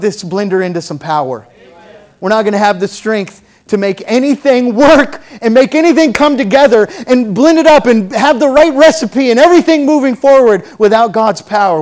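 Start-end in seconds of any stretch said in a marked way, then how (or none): 2.2-11.8 loud, driving sermon build; full-force preaching. Real, not acted. Preacher telling congregation they need God's power, loud and fervent. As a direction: pile up the phrases with rising fervor.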